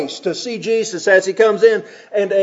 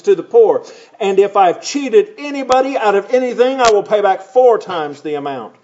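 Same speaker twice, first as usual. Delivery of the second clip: scripture reading; 3 semitones higher, 3 dB louder than usual. Acted. Reads scripture aloud.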